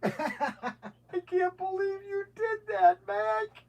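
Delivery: high and slow, laughing through the words; laughing high at a friend's mishap; laughing disbelief. Real, not acted. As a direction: giggle it out in disbelief.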